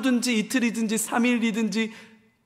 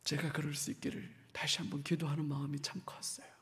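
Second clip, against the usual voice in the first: whisper; 4 semitones lower, 16 dB quieter, faster than usual. Real, not acted. Confessing prayerful heart.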